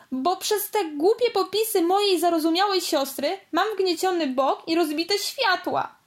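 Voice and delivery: a brother blaming his show-off sister; angry. Acted. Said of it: "powiedział ze złością". (ze złością, podniesionym głosem)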